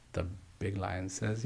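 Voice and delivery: ordinary talk, nothing marked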